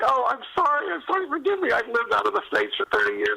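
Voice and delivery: high, hammed-up groveling apology; high mock-pleading apology, playing himself. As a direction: squeak out a mock sorry.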